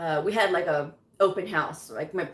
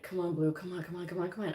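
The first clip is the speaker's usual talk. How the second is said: much softer, urging herself under the breath; muttered pep talk; 8 dB quieter, faster than usual. Retold or imitated. Blue urging herself on before speaking, softly muttered. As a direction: psych yourself up quietly.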